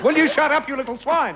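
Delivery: high, jokey telling-off; mock scolding. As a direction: pretend to scold a naughty child. Host mock-scolds noisy panelist.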